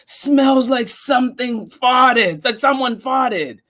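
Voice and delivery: pinch your nose and jeer; mocking kid. Classmate mocking the smelly lunch, disgusted.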